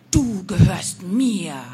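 slow, loud, possessive witch cry; witch calling out. A narrator's reading. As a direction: claim the girl like a gloating witch. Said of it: "rief dieser Hund-, Mund".

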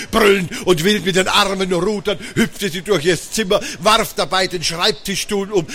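plain reading voice

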